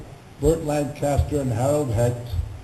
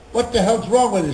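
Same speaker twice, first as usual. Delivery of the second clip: imitated irritated outburst, louder and higher; raised-voice retold reproach; exasperated scolding; 6 semitones higher, 5 dB louder than usual. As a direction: demand an answer, mock annoyed. Retelling the writer's exasperated challenge.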